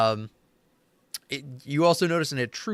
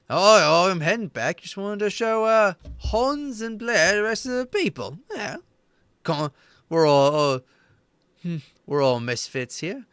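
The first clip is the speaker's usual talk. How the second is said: breezy NPC excuse; 7 semitones higher than usual. Acted. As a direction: brush off the order lightly. Elise voiced, brushing off reprimand.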